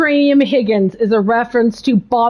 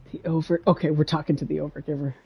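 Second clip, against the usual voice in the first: quiet aside; 10 semitones lower, 11 dB quieter, much faster than usual. Real, not acted. Aside to the audience.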